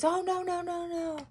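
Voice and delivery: high, quick apologetic backpedal; flustered neighbor. Retold or imitated. Retelling how the neighbor apologized, high and hurried. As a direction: squeak out no no no and hurry the promise. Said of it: "And she goes".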